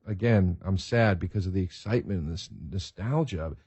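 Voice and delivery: ordinary delivery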